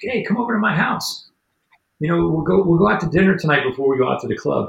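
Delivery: a breezy mock invite from a club girl; mock girly voice. Club promoter girl inviting him over.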